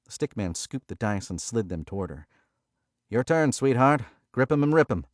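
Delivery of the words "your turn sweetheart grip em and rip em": loud, higher cheerful dealer; dealer patter. A narrator's reading. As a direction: egg on the new shooter. Stickman hands dice.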